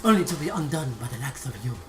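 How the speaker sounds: low, grand boss monologue; villain voice. Acted. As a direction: deliver it like a defeated dungeon lord. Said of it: "I have a lot of voices".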